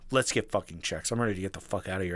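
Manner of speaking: low, quick, hushed irritation; low and rushed, imitating an angry girlfriend; retold anger. Girlfriend wanting to leave, retold with low irritation. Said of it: "slightly less angry than that".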